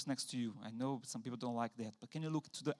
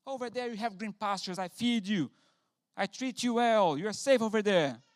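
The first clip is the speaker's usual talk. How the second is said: higher, louder scolding rant; furious owner; 5 semitones higher, 6 dB louder than usual. Retold or imitated. Angry owner scolding a runaway sheep, shouting. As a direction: scold the stubborn sheep in a rage. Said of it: "Instead of getting furious with the sheep".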